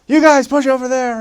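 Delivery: high, loud imitation of barking directions; bossy shouted orders. Mission president directing riot police, loud high-pitched ordering. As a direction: call out commands over a crowd. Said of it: "starts telling them where to go".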